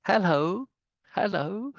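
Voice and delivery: high English "hello" with a rising end; English accent. Shown as a demonstration. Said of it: "That's not English".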